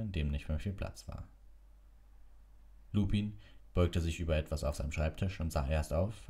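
ordinary delivery

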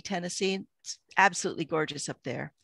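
normal talking voice

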